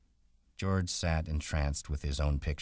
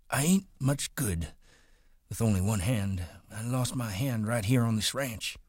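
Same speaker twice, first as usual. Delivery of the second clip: put-on much higher, old man pleading earnestly; old Candy; 9 semitones higher than usual. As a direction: offer your savings, hopeful. Old Candy pleads his case.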